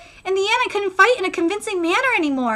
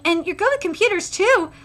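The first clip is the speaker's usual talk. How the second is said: ordinary delivery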